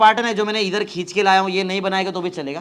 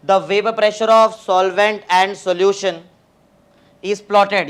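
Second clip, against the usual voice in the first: slow dictation; 4 dB louder, much slower than usual. Shown as a demonstration. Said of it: "थोड़ा fast लिखना".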